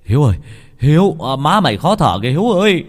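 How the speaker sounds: loud urgent calls, pitch climbing; shouting after him. A narrator's reading. Neighbour chases Hiếu about his choking mother, shouting. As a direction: yell to make him turn back. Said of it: "rượt theo kêu".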